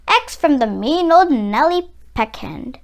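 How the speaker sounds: ordinary delivery, nothing marked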